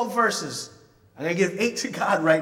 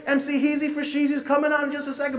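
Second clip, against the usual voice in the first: loud, high, fast hype man pumping the crowd; 9 semitones higher, 5 dB louder, faster than usual. Retold or imitated. Hype man firing up a rap concert crowd, loud and high. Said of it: "the hype man will come out and say".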